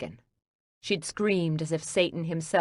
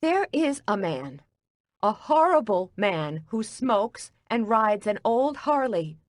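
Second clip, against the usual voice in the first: a baker furious at an intruder in her kitchen; angry outburst; 6 semitones higher than usual. Acted. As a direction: voice pitched much higher, upset and ranting. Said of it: "I'd never heard her so angry".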